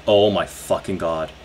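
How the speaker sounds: usual speaking voice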